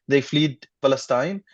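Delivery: ordinary talk, nothing marked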